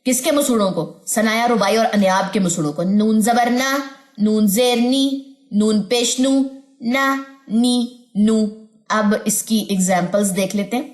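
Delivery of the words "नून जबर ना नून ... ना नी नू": slow, drawn-out ना, नी, नू; measured spelling drill with long vowels on ना, नी, नू; recitation drill. Shown as a demonstration. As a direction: stretch each of ना, नी, नू on a level note. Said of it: "नून को simple पहले देख लें".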